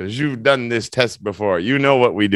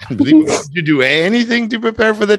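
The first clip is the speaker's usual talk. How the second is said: mock disbelief; 10 semitones higher, 4 dB louder, faster than usual. Real, not acted. Pupil did nothing for luck.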